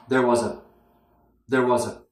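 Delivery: there was a run into one word; joined weak words. Shown as a demonstration. Teacher showing linked weak forms, run together. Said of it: "we join them together".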